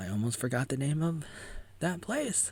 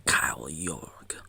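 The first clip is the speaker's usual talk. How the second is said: whispered name; 6 semitones lower, 4 dB louder, slower than usual. Acted.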